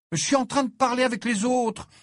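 high, indignant retort; outraged. Acted. Ginny refuses her mother's summons, outraged and high. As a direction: push back loudly at being interrupted. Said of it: "répondit Ginny outrée".

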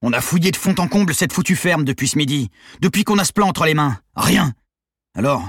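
furious, near-yelling thug; half-shouting. Acted. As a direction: bark it out, almost screaming. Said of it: "Valérino cria presque".

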